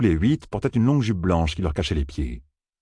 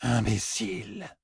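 soft, slow murmured insult; 5 semitones higher, 7 dB quieter, slower than usual. A narrator's reading. A fox-woman murmuring an insult at Matt. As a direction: breathe the word with contempt. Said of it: "murmura une des femmes".

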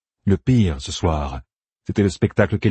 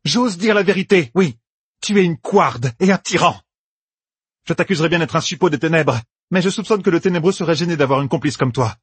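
higher-pitched, bold denunciation; defiant accuser; 10 semitones higher than usual. Acted. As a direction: throw the accusation in the tyrant's face. Egwene accuse Elaïda avec aplomb. (accusation lancée avec défi)

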